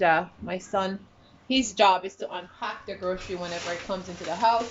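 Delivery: ordinary talk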